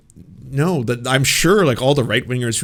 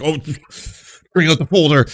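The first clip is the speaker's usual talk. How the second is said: mock eager; 5 semitones higher, 6 dB louder than usual. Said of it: "It's like".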